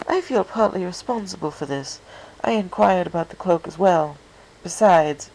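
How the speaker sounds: Rarity explaining where Fluttershy lives, high and refined; refined pony lady. A narrator's reading. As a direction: voice an elegant, polite lady. Rarity voiced by narrator.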